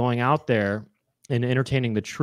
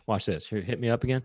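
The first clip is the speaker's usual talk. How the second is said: usual speaking voice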